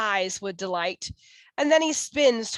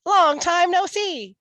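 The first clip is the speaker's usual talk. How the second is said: high hare voice; cheery hare voice; 8 semitones higher, 5 dB louder than usual. A narrator's reading. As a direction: greet like a jolly old friend. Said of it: "says Hare".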